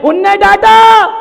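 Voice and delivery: high, drawn-out shout; thundering climax. Real, not acted. Preacher telling how the boss scolded him, shouted. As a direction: bellow the punchline.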